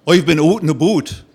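put-on thick Canadian accent, boat said as "but". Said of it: "a thick Canadian accent".

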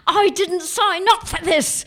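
high, wailing protest; hammed-up two-year-old temper tantrum; tantrum yell. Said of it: "yelling and crying and just throwing".

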